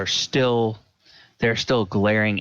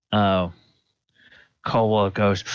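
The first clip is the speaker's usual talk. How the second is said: usual speaking voice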